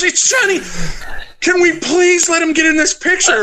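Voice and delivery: very high, pleading fan voice; gushing fan. Retold or imitated. Fan begging to add Johnny to the picture, high gushing plea. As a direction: beg sweetly like a starstruck fan. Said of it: "And she goes".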